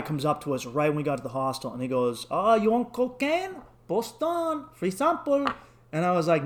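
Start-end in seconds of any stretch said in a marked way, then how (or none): 2.3-5.5 high, slow sales pitch; coaxing street dealer. Retold or imitated. Street dealer offers the new arrivals drugs, high coaxing voice. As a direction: imitate a Colombian dealer luring a tourist.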